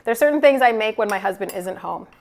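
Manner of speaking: usual speaking voice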